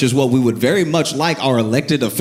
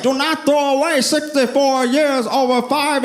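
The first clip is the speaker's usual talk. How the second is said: high-pitched imitated speechifying; mock slick supporter; 13 semitones higher than usual. Supporter defending the indicted mayor, high mock oratory. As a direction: plead the case in a high podium voice. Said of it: "this dude was slick with it".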